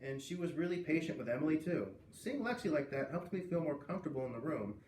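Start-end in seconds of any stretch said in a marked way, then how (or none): none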